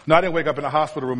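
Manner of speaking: rushed, matter-of-fact correction; quick retraction. Real, not acted. Preacher owning up that he exaggerated his story, quick retraction. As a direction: drop the drama and take it back fast. Takes back the dramatic hospital ending.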